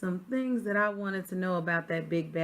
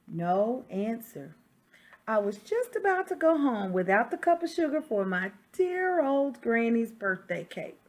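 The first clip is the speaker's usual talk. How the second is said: lively read-aloud as the wolf, a touch louder; story narration; 5 semitones higher, 7 dB louder than usual. Acted. Reading a picture book to children, storyteller voice. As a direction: tell it like the wolf defending himself.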